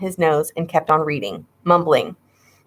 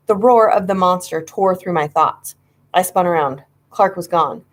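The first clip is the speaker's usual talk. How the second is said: usual speaking voice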